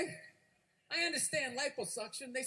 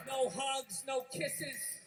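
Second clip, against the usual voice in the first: normal talking voice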